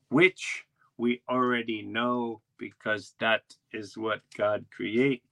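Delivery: slow, drawn-out island guide reciting in a daze; dazed tour guide. Retold or imitated. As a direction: recite the tour slowly, as if nobody is listening. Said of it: "he just said it in a daze".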